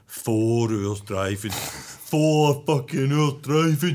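slow, drawn-out mock moan; hammed-up slow complaint about a long drive; imitating weary grumbler. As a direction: grumble it word by word, dragging every syllable. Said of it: "done an impression of myself".

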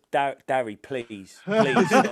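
begging him to stop through laughter; pleading, cracking up mid-line; laughing plea. Real, not acted. Begging friend to stop joking.

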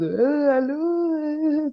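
imite un mec tout juste réveillé, voix plus haute; allô pâteux de quelqu'un qui sort de la sieste; voix endormie. Retold or imitated. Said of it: "avec une voix endormie en mode". (voix endormie imitée)

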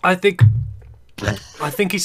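coughing through a swallowed drink; choked-up voice after a drink; choking on a drink. Real, not acted. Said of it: "I was choking on drink".

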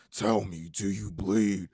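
low threat in a deep growl; imitating Batman. Host quoting Batman in the trailer, deep menacing growl. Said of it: "when he said".

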